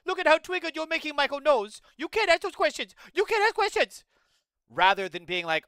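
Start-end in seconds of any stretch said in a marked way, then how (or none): 0.1-4.0 much higher, louder, whiny scolding; mocking moderator. Streamer mocks a moderator shielding Michael Knowles, high whiny scolding. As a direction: whine like a fussy scold protecting him. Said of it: "so she's gonna turn on him, being like".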